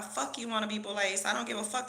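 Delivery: normal talking voice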